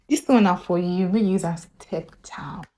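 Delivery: hushed secret whisper; David whispering gossip to his friend. Acted. Secret aside.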